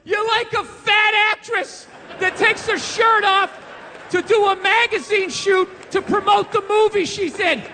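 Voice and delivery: high, slow, breathless mock praise; mock gushing awe. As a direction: gush sarcastically like it is heroic. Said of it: "Am I gonna sit there like".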